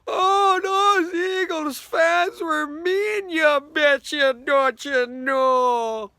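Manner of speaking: put-on high, slow, whimpering Minnesota accent; mock-sobbing Vikings fan in a Minnesota accent; whiny Minnesota accent. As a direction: whine it like a sore loser from Minnesota. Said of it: "it was all".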